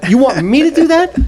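high, disbelieving question; a stand-up comic handed a sitter job, incredulous. Retold or imitated. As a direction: say it like they can't be serious. Asked to babysit Beetlejuice.

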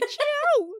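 high, loud "chew" like a cat's mew; drawn-out, high-pitched kitty "chew"; cutesy sign-off squeak. Shown as a demonstration. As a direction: squeal "chew" as a playful goodbye. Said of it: "I'm gonna say".

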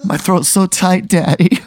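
high, slow, hammed-up purr; drawn-out mock-seductive whine; mock-sexy voice. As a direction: ham up the innuendo in a high voice. Player hams up the innuendo.

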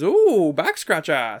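drawn-out mock delight; delighted waiter. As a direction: imitate a waiter savoring the gag. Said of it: "And he goes".

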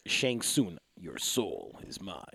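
very low, slow, quiet villain voice; hushed, deep fighting-game villain; mock villain line. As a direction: drop deep and drag it out ominously. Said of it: "She's saying".

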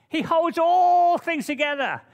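"all" hit loud and high; stress on all. Real, not acted. Preacher to church, stress on "all". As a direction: lean on "all" with full force. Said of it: "I emphasize that word".

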